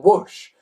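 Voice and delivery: loud airy whoosh; whoosh sound. Acted. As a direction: blow the word out like flying wind. Sound effect of flight.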